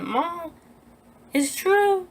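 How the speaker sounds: high, tearful plea to mom; retold crying. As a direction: re-enact wailing the news to your mother. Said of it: "Why are you crying?".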